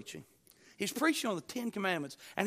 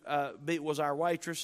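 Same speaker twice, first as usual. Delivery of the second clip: usual speaking voice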